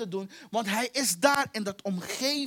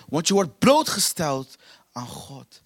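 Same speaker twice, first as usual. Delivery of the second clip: normal talking voice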